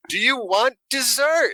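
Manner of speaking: over-enunciate it as if to someone with a hearing aid; drawn-out, high-pitched dessert offer; waitress shouting to the deaf. Retold or imitated. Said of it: "And this is, like, right next to us".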